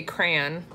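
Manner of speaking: "crayon" squashed into one syllable, like cran. Shown as a demonstration. Showing viewers her own way that Dan mocks, one-syllable crayon. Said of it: "I say crayon, and he makes fun".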